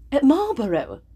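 a mild surprised cry; cried out. A narrator's reading. Eleanor startled by Willoughby's answer, crying out. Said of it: "cried Eleanor".

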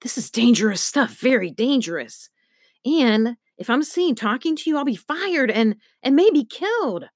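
a nervous man climbing into a panicked high pitch; frightened. Acted. Frightened man whispering warnings.

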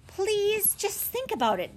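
high-pitched desperate please; a touch of pleading from the rebuffed campaign man; pleading cry. Acted. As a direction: beg them not to refuse. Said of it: "cried Mister Winters".

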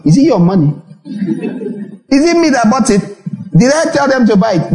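sharp high rebuttal; indignant retort. Real, not acted. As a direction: push back at the critics, pitch up.

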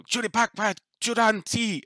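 put-on high-pitched childlike creature chatter; yelling little dryad. Little dryad yelling at the bigger one, high-pitched protest. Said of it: "yells at the bigger one".

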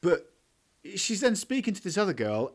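ordinary talk

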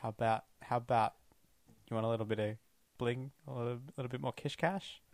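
hushed, secretive recruiter voice; stage whisper. Acted. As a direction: lean in and whisper the offer. Recruiting Anthony into Spectre.

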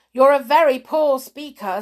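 loud, high royal rebuke; scornful king. A narrator's reading. King dismissing the Hatter's plea, loud high rebuke. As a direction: put him down loudly. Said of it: "said the king".

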